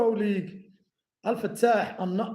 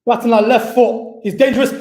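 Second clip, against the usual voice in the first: rising excitement, louder; excited build-up; 8 dB louder than usual. Real, not acted. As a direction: commentator sensing danger. Build-up to goal.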